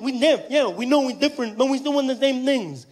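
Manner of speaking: high-pitched imitation of a deaf speaker; high put-on deaf voice; deaf man's voice. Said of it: "He made jokes about deaf people".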